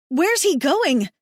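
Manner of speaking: woman cries after the departing leader, desperate; desperate cry. Acted. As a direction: cry out after him in despair. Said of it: "the woman cries, desperate".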